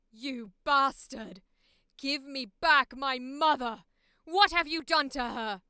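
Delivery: furious yelling, slow and heavy; furious shout. Acted. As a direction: scream at the kidnapper, full rage. Audition shout.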